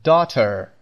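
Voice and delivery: daughter with an American pronounced final R; American accent. Shown as a demonstration. Teacher contrasting it with British for learners, American accent.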